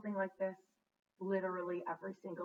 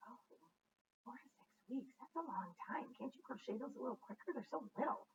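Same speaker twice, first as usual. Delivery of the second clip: small, hushed, higher-pitched mock whine; joking complaint; 4 semitones higher, 7 dB quieter than usual. Jokingly grumbling about the long earring wait, mock complaint. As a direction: playfully plead in a soft, high voice. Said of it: "I'm just kidding".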